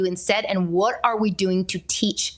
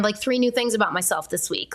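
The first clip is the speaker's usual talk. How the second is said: ordinary talk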